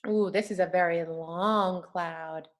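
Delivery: usual speaking voice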